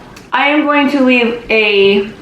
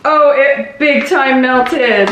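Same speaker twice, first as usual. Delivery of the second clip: ordinary talk, nothing marked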